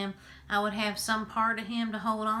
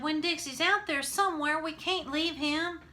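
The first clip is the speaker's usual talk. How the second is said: pleading cry; 9 semitones higher, 4 dB louder than usual. A narrator's reading. Opal pleading with preacher.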